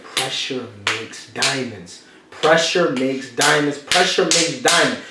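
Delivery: slow, weighty repeat of pressure makes diamonds; deliberate slogan, each take a bit stronger; slow emphatic mantra. Real, not acted. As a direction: drive the line home. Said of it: "repeated for emphasis".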